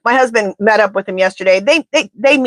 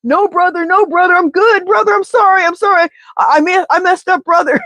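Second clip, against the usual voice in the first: higher pleading wrestler voice, apologizing fast; Hulk Hogan backing down; 8 semitones higher than usual. Retold or imitated. Hulk Hogan apologizing to Bear, higher and pleading. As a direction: grovel like a scared wrestler. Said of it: "Hulk Hogan was like this".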